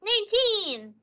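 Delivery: high, drawn-out cry of the number; exclaimed roll. Real, not acted. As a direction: call out the dice roll in a high voice. Player calls out a dice roll.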